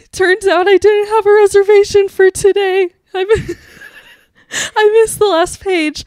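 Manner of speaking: high, loud mock-crying wail; hammed-up wailing. Telling the kind girl her mistake, exaggerated tearful wail. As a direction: wail it out, pretending to sob. Acts out tearfully answering the angel.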